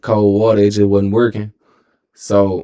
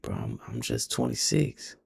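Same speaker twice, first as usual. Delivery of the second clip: soft, small voice; barely saying it, stunned; quiet disbelief; 2 semitones higher, 12 dB quieter than usual. Retold or imitated. Told he could have stroked and died, quiet disbelief.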